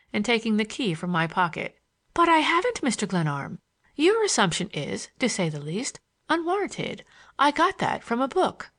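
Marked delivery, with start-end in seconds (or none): 2.1-8.7 high, mock-formal girlish reproof; pert schoolgirl. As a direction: play a pert schoolgirl trying out bookish words. Voices the schoolgirl in dialogue.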